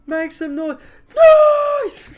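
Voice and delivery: high, loud crowd yell on "noise!"; shouting noise. Retold or imitated. Showing how he yelled at gigs, a full shout. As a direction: scream it like a concert crowd. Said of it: "I used to literally shout the word noise".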